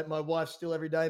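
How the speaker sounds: ordinary talk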